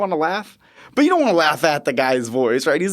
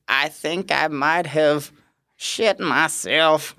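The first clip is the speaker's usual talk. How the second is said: slow, lightly voiced patient; sheepish patient; much slower than usual. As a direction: confess it slowly like the patient. Patient confessing.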